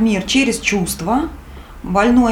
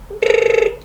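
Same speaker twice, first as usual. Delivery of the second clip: lip trill; 13 semitones higher, 4 dB louder than usual. Pretend. Mic test noise.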